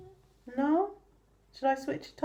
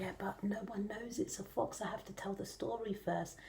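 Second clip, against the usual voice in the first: whispering; 4 semitones lower, 14 dB quieter than usual. Real, not acted. Whispers to keep secret.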